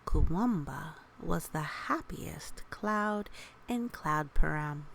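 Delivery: put-on gentle read-aloud to children; storybook narration. A narrator's reading. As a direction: read it like a bedtime picture book.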